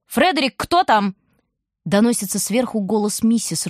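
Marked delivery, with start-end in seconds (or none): 0.1-1.1 higher call down the stairs; wife calls down to Frederick; calling from upstairs. A narrator's reading. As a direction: call to husband.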